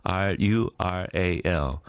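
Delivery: slow letter-by-letter spelling; spelled out. Shown as a demonstration. Host spells the hard word for listeners. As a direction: spell each letter slowly. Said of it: "R과 L의 조합은 참".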